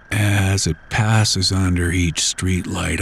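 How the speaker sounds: usual speaking voice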